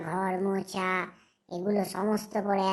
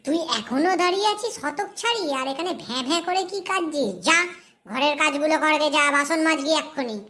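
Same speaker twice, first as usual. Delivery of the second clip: raised, high-pitched telling-off; angry scolding; 4 semitones higher, 5 dB louder than usual. Acted. Aunt sending the crying girl to the dishes, angry scolding. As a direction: berate with no patience.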